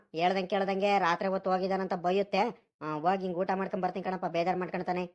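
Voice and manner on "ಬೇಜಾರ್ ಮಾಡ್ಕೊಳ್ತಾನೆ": usual speaking voice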